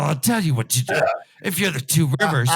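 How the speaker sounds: ordinary delivery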